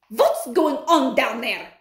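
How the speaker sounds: slow, loud stern witch; angry witch. Acted. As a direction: demand it from the platform. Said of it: "shouted the Grand High Witch".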